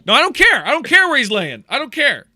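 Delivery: acted brother shouting, straining against the crowd; furious shout. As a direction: yell it over the people holding you. Brother held back, shouting.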